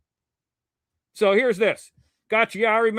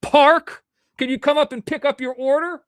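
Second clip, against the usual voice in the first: calling out the order; 9 semitones higher, 6 dB louder than usual. Retold or imitated. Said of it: "And they go".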